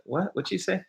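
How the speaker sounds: startled high what, spun round; surprised what. Retold or imitated. Turning round to a stranger, startled and high. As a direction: caught off guard, pitch jumps up.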